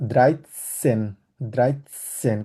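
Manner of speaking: Dreizehn said very slowly, with a clear ts sound; slow German pronunciation. Shown as a demonstration. Teacher slowly modeling Dreizehn for the class. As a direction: spell out Dreizehn slowly for students. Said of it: "কারণ আমরা সেটকে সবসময় এস বলে থাকি".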